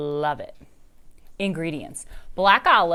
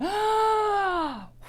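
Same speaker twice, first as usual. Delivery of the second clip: sharp gasp. Acted. Dory recognizes the voice.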